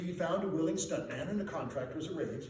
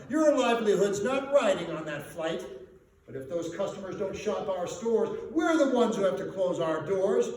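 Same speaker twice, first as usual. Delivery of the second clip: louder, hammering each line at him; angry rant; 8 dB louder than usual. Acted. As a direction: lay into him, line after line. Merchants berate McPhee.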